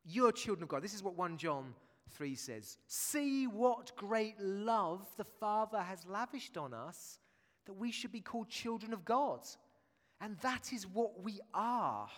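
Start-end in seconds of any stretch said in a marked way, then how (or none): 2.9-12.1 slow, solemn reading of the verse; scripture reading. Acted. Preacher reading one John three aloud to the church, slow and solemn. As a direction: read it out slowly and reverently.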